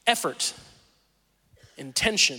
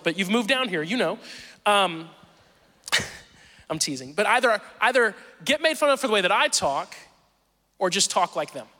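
usual speaking voice

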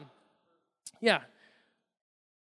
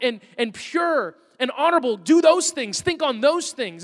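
high, loud, ringing exhortation; full-force preaching; 7 semitones higher, 7 dB louder than usual. Acted. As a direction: proclaim it to the whole congregation.

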